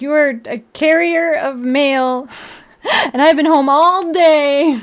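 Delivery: slow, emphatic, exasperated; exasperated rant. Retold or imitated. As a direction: spell it out, fed up. Ranting at the mail carrier.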